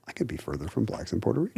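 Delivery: soft, low murmur to oneself; unspoken thought. Retold or imitated. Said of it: "they were thinking, on some level".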